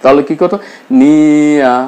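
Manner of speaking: usual speaking voice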